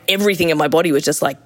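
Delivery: usual speaking voice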